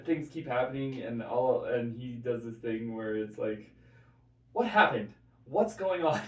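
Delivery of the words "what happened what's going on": higher, louder, bewildered newsman; Tucker Carlson impression. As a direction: play him baffled and indignant. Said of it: "did an impression of Tucker Carlson".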